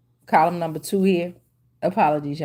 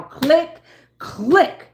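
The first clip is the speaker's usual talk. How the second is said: mock camera click; 6 semitones higher, 3 dB louder than usual. Camera shutter imitation.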